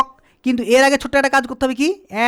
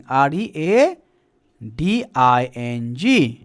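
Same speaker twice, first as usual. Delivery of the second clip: slow spelling; 10 semitones lower, much slower than usual. Shown as a demonstration. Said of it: "teacher spelling the word".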